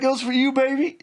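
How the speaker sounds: high-pitched imitation of a sheepish excuse; mock pleading. Caught man placating his wife, mock high pleading.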